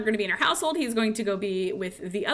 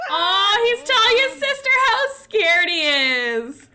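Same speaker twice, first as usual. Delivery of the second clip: excited squeal; 10 semitones higher, 8 dB louder, slower than usual. Real, not acted. Excited commentary on sim.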